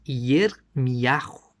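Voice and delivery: Yermiyahu very slow and drawn out; Hebrew pronunciation. Shown as a demonstration. Giving Jeremiah's original Hebrew name, very slowly. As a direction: stretch Yermiyahu across every syllable.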